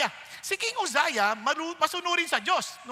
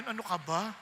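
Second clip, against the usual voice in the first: ordinary delivery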